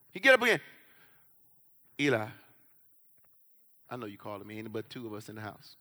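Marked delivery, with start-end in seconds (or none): 2.0-5.8 hushed plea. Acted. Samuel voiced in story.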